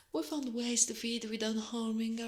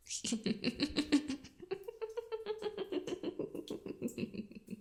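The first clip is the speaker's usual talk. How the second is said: long, high tittering laugh that sinks at the end; creepy villain giggle; 11 semitones higher, 4 dB quieter than usual. Acted. Arachne to the guest in her humble abode, creepy drawn-out giggle. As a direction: titter on and on like a creature toying with prey.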